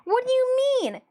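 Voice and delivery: high, puzzled mock viewer voice, lightly voiced; doubting viewer. Mocking a fan who doubts the cosplay struggle, high and puzzled. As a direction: play a confused fan who thinks it looks fine. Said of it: "if any of you are like".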